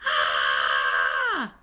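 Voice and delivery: long, loud scare scream; scariest scream. A narrator's reading. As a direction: scream to frighten Grandma. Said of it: "I did my scariest scream".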